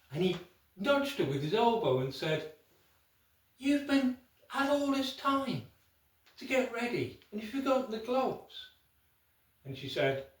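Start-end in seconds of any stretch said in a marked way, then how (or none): 3.6-8.7 high, loud, impatient scolding; husband telling off his wife at the wedding, exasperated. Acted. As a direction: snap at her for forgetting the gloves again. Husband nudges wife, scolds her.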